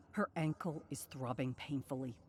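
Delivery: plain reading voice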